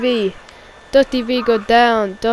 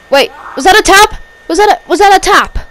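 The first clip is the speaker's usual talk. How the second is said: high, loud disbelieving question; astonished; 8 semitones higher, 8 dB louder than usual. Real, not acted. Commentator asking if that was a tap, astonished shout. Spots a possible submission.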